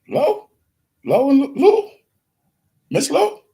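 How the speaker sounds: high, loud incredulous questions; mocking. Kids mocking the name in retold scene.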